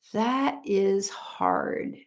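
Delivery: hushed, slow whisper. Real, not acted. Thinking aloud about a hard question, slow whisper. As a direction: breathe it out, stumped.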